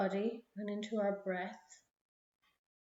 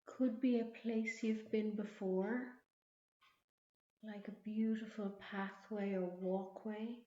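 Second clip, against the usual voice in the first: guided meditation. Acted. Leads a relaxation visualization.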